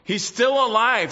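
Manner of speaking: "still" hit high and loud in "He's still alive."; proclaimed. Acted. Preacher declaring the risen Lord to the church, loud and high.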